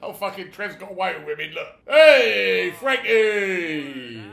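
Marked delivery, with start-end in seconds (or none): 1.9-4.2 loud hail; calling out. Real, not acted. Player hailing Frankie in the game, loud call. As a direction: call out across the street.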